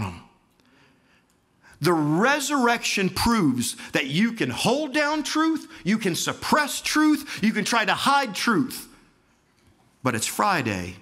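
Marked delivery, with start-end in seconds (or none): 1.8-8.9 high, loud, impassioned testimony; preaching at full force. Acted. Preacher testifying to the congregation about leaving Allah, impassioned. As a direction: pour it out, climbing with each question. Climactic proclamation.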